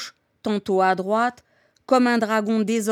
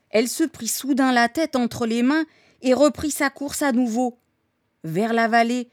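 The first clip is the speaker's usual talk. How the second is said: plain reading voice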